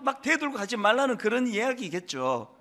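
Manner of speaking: ordinary delivery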